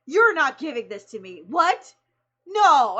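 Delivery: loud, astonished refusal; mock protest, loud; a playful, disbelieving no; excited disbelief. Quoting the actor refusing the gift.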